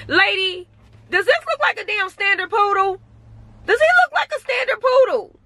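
high-pitched, incredulous challenge; indignant retort. Retold or imitated. Dog owner retorting to mistaken lady, high-pitched indignation. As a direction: push the pitch way up in disbelief.

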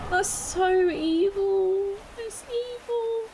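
high tearful murmur; teary disbelief. Real, not acted. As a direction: let the tears catch. Reacting to the game.

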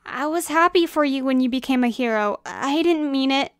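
regretful, high-pitched and insistent; distressed apology. Acted. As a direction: plead urgently for forgiveness. Frantic apology after hurtful words.

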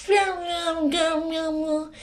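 child mimicking a drunken mumble; imitated tipsy, wordless garble; slurred drunk gibberish. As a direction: slur it all into mushy nonsense. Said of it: "I hate when she talk like that".

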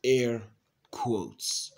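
"Air" loud, a pause, then "quotes" falling; deliberate quoting. Shown as a demonstration. Repeating what was said in the past, "Air quotes" in two deliberate beats. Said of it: "All right, I'll say it".

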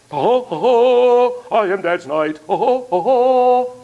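booming slow ho ho ho; knight voice. Acted. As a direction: play a jolly knight. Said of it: "the guy went into song".

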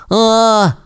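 high yelp of fright; short scream. A narrator's reading. Rasmus on the creaking bridge, high short yelp. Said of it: "закричав Расмус".

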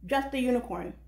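the title of a poem set apart, said with weight; poem reading. Shown as a demonstration. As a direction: open the poem like a spoken word stage. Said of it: "the way that I intended it to be heard".

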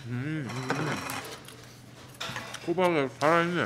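chewing while talking; eating at the table; mouth full. Real, not acted. Seen 0: Left diner's cheeks bulge and jaw works while eating between bites.

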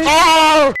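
high, loud jolt of a scream; startled scream. Real, not acted. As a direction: yelp at the monster. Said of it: "Qué cagazo que me pegué". (grito de sobresalto)

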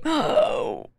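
sudden audible gasp; gasp of realisation. Real, not acted. Viewer realises the game is starting, gasping. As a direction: gasp as it dawns on you.

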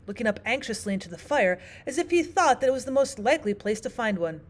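usual speaking voice

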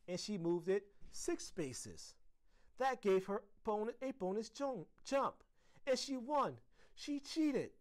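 high, loud, building complaint; rising high-pitched child's accusation; upset child accusing. A narrator's reading. Tearful girl accuses friend to teacher.

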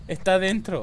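loud high frantic screaming; yelling in terror; panicking gamer. Real, not acted. Ghost hunting, players screaming.

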